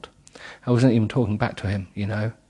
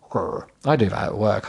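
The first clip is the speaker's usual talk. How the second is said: ordinary delivery, nothing marked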